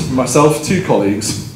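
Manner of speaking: normal talking voice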